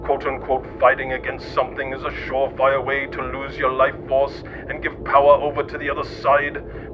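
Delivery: usual speaking voice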